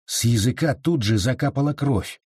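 ordinary delivery

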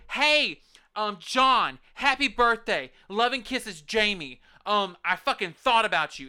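a sugary little birthday greeting, half mocking; sweet birthday note. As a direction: put on a syrupy friendly voice. Mock-acting a friendly birthday message.

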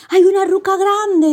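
high, excited little-hero call; excited discovery. A narrator's reading. Pulgarcito spots a hut from the treetop, high excited call.

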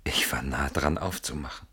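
tonloses, verstohlenes Flüstern; hauchen, damit es draußen keiner hört; geflüstert. Acted. Flüstern, Mann lauscht hinter Tür. (gesteht flüsternd, dass er fast die Tür geöffnet hätte)

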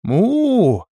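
loud, high drawn-out moo. Acted. Лирея showing her friend the demons' cries, loud mooing. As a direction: imitate a cow's moo, pitched well up.

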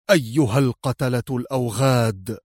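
ordinary delivery, nothing marked

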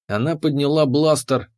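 ordinary delivery, nothing marked

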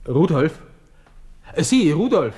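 calling loudly across the café for the waiter; sharp high call to summon the waiter. Acted. Ruft den Kellner herbei. (laut und hoch nach dem Kellner rufen)

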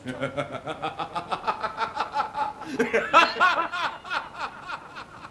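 long high laugh. Acted. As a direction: laugh it off freely. Laughter after the scolding.

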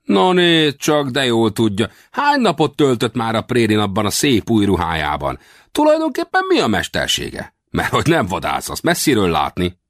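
high-pitched, nosy little westerner, mocking; cocky little frontiersman. Little westerner quizzing the stranger in fine new clothes, high and mocking. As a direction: size up a greenhorn in a high character voice.

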